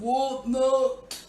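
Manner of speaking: high, slow, loud sleepy tree; a grumpy tree spirit just woken up. Acted. GM plays tree.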